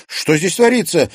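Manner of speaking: high, loud cry on rushing in; shouting. A narrator's reading. Liza runs into the flooded hall, shouting. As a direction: call out loudly, pitch jumping up. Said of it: "крикнула, вбегая Лиза".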